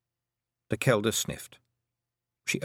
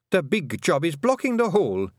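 sulky; 9 semitones higher, 4 dB louder than usual. A narrator's reading. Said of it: "said Fionn sulkily".

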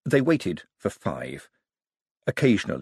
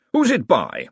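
louder put-on wizard voice; lightly caricatured wizard, raised in volume; 5 semitones higher, 4 dB louder, slower than usual. A narrator's reading. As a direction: fob them off as a stuffy wizard. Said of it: "said the wizard".

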